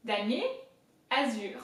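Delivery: "damier, azur" in careful French; French word drill, "azur" with a strong final R; heavy French R. Shown as a demonstration. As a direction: say "azur" ending on a throaty R, after a separate "damier". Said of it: "very high and heavy emphasis on the R".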